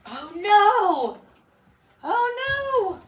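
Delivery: put-on a mother watching a child wander off; alarmed cry. A narrator's reading. Woman sees child going wrong.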